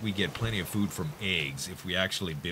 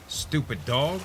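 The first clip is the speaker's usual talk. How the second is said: cranky cartoon grandpa; 8 semitones higher, 4 dB louder, slower than usual. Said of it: "reminds me of the old grandpa in Courage".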